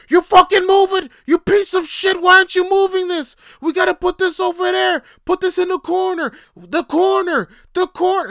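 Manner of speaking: imitating a coworker screaming at an old man; furious yelling. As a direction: scream it like a bullying foreman. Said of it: "And it's, like, yelling".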